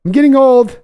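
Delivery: put-on high, loud whiny old-man wail on getting old; mock old man lament. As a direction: wail I'm getting old like a dramatic geezer. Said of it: "as I start to go".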